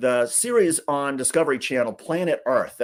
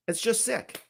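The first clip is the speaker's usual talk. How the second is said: It's just sick lifted much higher yet soft, just stressed; disbelieving, pitched up and hushed; higher, soft disgust; 10 semitones higher, 6 dB quieter than usual. Real, not acted. Disgust at Trump's photos.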